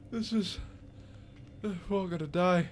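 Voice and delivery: put-on high, distraught old prisoner voice; despairing old man. Old man tells fellow prisoners they're doomed, despairing. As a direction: lament that everyone is doomed. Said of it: "you hear the old man go".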